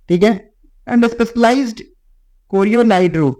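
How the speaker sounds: ordinary talk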